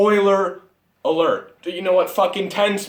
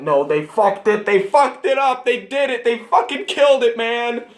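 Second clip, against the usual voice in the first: rising furious yells, much higher and louder; angry outburst; 6 semitones higher, 4 dB louder than usual. Real, not acted. Fan ranting at the camera, furious yelling. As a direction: explode at the ruined movie. Ranting about the ruined movie.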